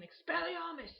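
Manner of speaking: high, loud spell cry; shouting a spell. Retold or imitated. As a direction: fling the spell out like a wizard.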